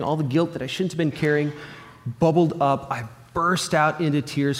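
usual speaking voice

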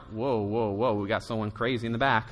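low, quiet startled mutter; mock alarm at someone crazy in the back; lightly voiced uneasy churchgoer; tense listener. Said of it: "a little tense, a little awkward, like".

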